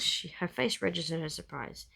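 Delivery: ordinary delivery, nothing marked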